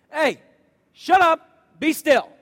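loud, high, irritated snap; woken-up rebuke. Retold or imitated. Jesus snapping at the panicking disciples, irritated. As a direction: bark it like someone just woken. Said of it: "He says".

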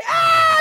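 hammed-up infant shriek; mock baby scream. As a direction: wail like a baby again. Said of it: "I start screaming again".

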